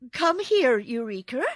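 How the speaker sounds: high, loud summons; calling the kitten. A narrator's reading. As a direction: call out as a girl to her cat. Girl calls kitten from under bed.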